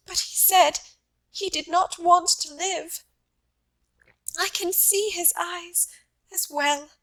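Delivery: lightly voiced wife, growing upset. A narrator's reading. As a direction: let the memory climb towards tears.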